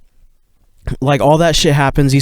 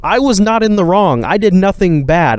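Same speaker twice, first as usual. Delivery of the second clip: high, pitched-up protest that climbs; frustrated rant, voice climbing higher; exasperated venting; 7 semitones higher than usual. Real, not acted. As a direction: plead your innocence in rising exasperation. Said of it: "venting own innocence, rising".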